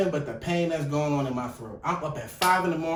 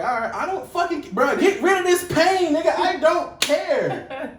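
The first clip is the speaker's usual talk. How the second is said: higher, louder pained plea; desperate mock yell; 8 semitones higher, 5 dB louder than usual. Begging hospital staff to kill his throat pain, loud desperate plea. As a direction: beg loudly to end the pain.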